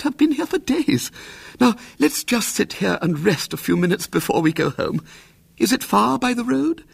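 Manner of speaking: put-on high, gushing old lady relief; relieved aunt. A narrator's reading. Relieved aunt thanking William, high and gushing. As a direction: gush with relief.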